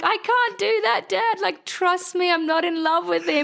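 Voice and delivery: very high, slow pleading voice; pleading daughter. Retold or imitated. Her younger self pleading.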